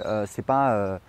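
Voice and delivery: ordinary talk, nothing marked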